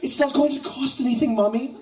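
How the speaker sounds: put-on high, loud childish pleading voice; hammed-up posh child begging mommy; British college student impression. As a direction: whine at mommy like a spoiled boy. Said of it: "my impression of a British college student".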